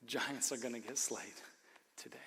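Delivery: hushed whisper of a promise; dramatic whisper. Acted. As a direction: lean in and whisper it like a secret.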